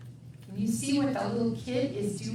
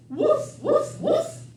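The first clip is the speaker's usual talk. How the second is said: high, louder yapping woof; dog bark; 6 dB louder, slower than usual. Acted. Reading the farm book, barking like the dogs.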